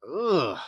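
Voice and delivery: exasperated groan of disgust. Real, not acted. Reaction to the film's ending.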